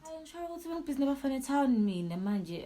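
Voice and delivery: high, loud mocking mimicry; mocking father. Mimicking her father's accusation, high and loud mockery. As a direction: mimic his accusation with scorn. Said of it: "mocking father's accusation".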